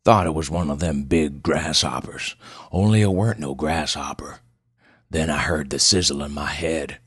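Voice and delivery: put-on gruff backwoods old storyteller; gruff old man. Old patient describes the sizzle in his head, gruff. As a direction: spin it out like an old mountain man. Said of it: "Pleasanton's voice was as gruff as his appearance".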